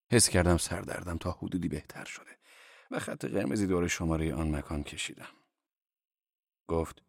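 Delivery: ordinary delivery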